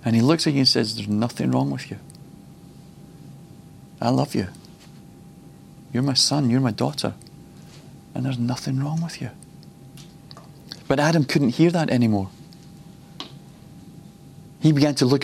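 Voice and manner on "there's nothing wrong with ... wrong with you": soft, loving reassurance; a hushed, tender father's voice; tender God. Acted. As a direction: speak it gently, like a father to his child. God speaking to his children.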